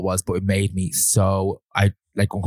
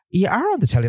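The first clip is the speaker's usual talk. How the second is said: high, insistent mock voice; insistent woman; 11 semitones higher than usual. Pushy fan insisting in the aisle, high mock voice. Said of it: "and she goes".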